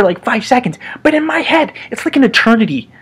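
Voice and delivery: ordinary delivery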